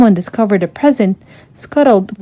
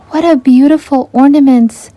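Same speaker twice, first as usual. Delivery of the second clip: delighted; 5 semitones higher, 5 dB louder than usual. A narrator's reading. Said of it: "Ariel told the sales clerk".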